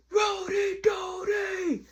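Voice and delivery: high, drawn-out holler up to a window; mimicked long-distance yell; yelling a name. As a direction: call up from the street, stretching each word. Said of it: "yelling my name like if we outside at the projects".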